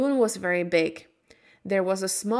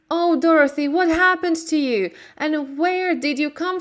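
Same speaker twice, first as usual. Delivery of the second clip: higher, louder excited aunt; overjoyed; 8 semitones higher, 4 dB louder than usual. A narrator's reading. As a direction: burst with relief and joy.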